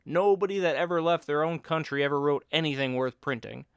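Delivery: a pal pontificating for laughs; Bill's voice. Acted. Bill sermonising about coffee and expatriates, loud mock oratory.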